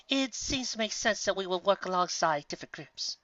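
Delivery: a hint of a genteel mare reasoning; Rarity. Rarity voiced in story.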